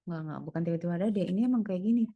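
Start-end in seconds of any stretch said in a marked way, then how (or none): none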